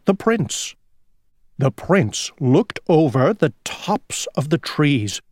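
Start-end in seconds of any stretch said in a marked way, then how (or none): none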